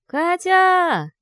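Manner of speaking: put-on high, loud child calling the name; child's voice calling. A narrator's reading. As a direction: call out like a distant child. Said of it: "Чей-то тихий, похожий на детский голос".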